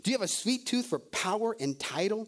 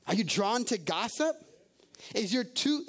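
ordinary delivery

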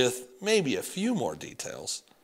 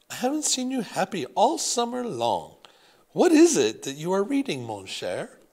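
put-on higher, louder French-accented grandmother; a French grandmother curious and doting; thick French accent; 8 semitones higher, 5 dB louder than usual. Grandmother asking grandson what he reads, French accent. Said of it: "Grandmere had a thick French accent".

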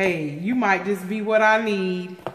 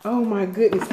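ordinary talk

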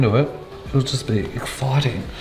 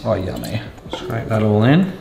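ordinary talk, nothing marked

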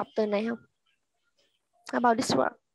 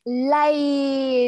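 LANE very long and drawn out; slow reading; 2 semitones higher than usual. Shown as a demonstration. Teacher showing how it sounds read slowly, LANE stretched. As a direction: hold the vowel of LANE, slowly. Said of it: "khi chúng ta đọc chậm".

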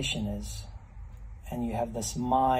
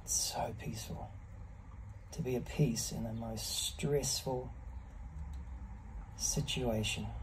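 whispering; 11 dB quieter than usual. Real, not acted. Calm reflection in ice bath.

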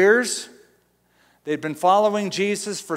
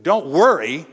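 incredulous; 6 dB louder than usual. Retold or imitated. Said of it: "You go".

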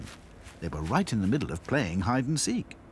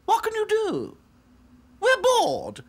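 high haughty doll voice; snooty little princess; 8 dB louder, slower than usual. A narrator's reading. Princess dolls.

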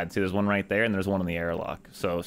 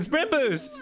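high excited yell; urgent shout. Real, not acted. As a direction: scream it at your teammate.

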